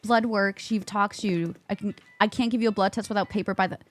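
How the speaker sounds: ordinary delivery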